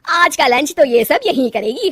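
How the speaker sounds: loud, insistent mother-in-law; bossy mother-in-law. Acted. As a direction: lay down the plan loudly for the household.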